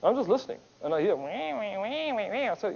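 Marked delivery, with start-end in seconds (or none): 1.2-2.5 high, whiny imitated crying; mock baby wailing. Speaker mimics the whining member's cries, mock wailing. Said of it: "Is that a baby crying?".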